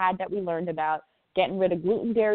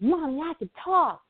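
put-on child's voice; 8 semitones higher than usual. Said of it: "and she goes".

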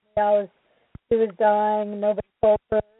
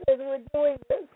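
tearful words through sobs; choked, crying voice; real crying; 2 semitones higher, 4 dB quieter than usual. Real, not acted. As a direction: speak while crying for real. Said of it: "I'm just crying so much now".

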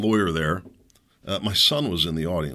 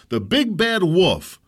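slow, loud sarcastic repeat; bitter mock repeat; scornful echo; 9 semitones higher, 5 dB louder, much slower than usual. Real, not acted. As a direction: throw the taunt back. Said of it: "repeats the taunt".

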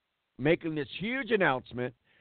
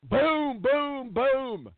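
mock fanfare; 9 semitones higher, much slower than usual. Mocking media rushing the story.

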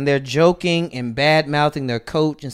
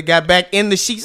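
ordinary delivery

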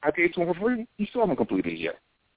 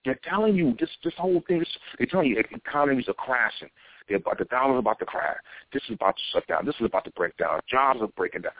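ordinary delivery

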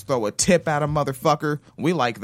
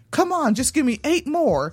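pushy fitness instructor; 9 semitones higher than usual. Retold or imitated. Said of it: "they do that bullshit where they say".

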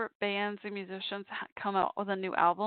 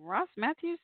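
man doing a woman's voice; 7 semitones higher, slower than usual. Retold or imitated. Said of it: "in her French Canadian accent".

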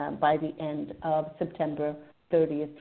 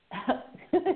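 a short bright laugh, pitch jumping up; light laugh; 9 semitones higher than usual. Real, not acted. Psychic on a call-in show laughing at the caller's brother. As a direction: let out a quick delighted laugh. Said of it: "he's laughing".

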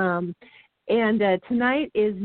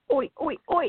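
higher chanted oi oi oi; Aussie cheer; 7 semitones higher than usual. Pretend. Cohost opens the Aussie guest segment with a chanted cheer. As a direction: chant it like a sports crowd. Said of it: "from our Aussie cheers".

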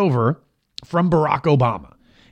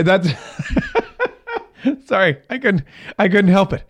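ordinary talk

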